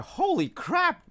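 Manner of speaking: loud, high, drawn-out mock amazement; astonished. Retold astonished reaction to the accent.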